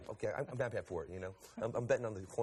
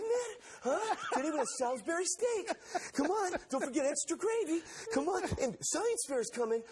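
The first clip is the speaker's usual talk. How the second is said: much higher, eager school announcer voice; Mark Wahlberg. Retold or imitated. Wahlberg reading announcements to an elementary school, very high. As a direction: pump up the kids like a hyped principal. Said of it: "he's doing elementary school announcements".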